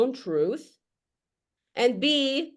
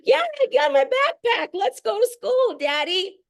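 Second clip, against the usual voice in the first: put-on much higher, bright little kid chatter; happy kid voice; 13 semitones higher, 3 dB louder than usual. Kids cheerfully telling their dad about hotel life, high kid voice. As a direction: sing it out like a carefree child. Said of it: "The children are like".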